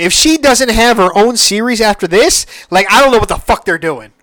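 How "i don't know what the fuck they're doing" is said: normal talking voice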